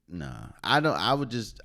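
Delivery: normal talking voice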